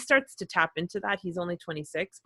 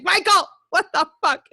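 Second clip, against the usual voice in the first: much higher, louder cry of shock; shocked outburst; 12 semitones higher, 8 dB louder than usual. Retold or imitated. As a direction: blurt it out, stunned mid-sob on the table. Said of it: "I'm like".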